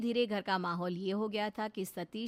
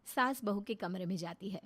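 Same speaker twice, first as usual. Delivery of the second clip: plain reading voice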